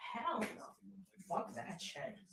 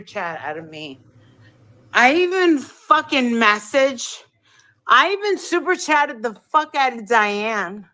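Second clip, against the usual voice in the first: loud demanding rant; 8 dB louder than usual. Real, not acted. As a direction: shout demands at the viewers. Streamer loudly demanding super chats.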